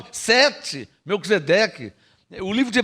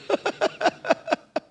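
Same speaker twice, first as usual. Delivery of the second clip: high breathy laugh; 11 semitones higher, 5 dB quieter than usual. Real, not acted. Preacher laughs at own paradox.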